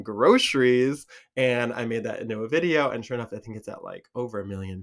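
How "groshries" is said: "groceries" drawn out as GROH-shreez, louder, with heavy sh; heavy shh. Shown as a demonstration. Creator explaining what Michiganders do, heavy shh on groceries. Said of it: "do a heavy shh".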